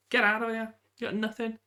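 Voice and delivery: high, cocky jeer at the rival; mock the beaten rival playfully; taunting. Real, not acted.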